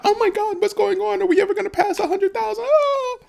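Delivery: high, fast, frantic squeal; mock a panicking new investor; squealing like a crypto newbie in a panic; panicked newbie. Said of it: "I overheard some people talking".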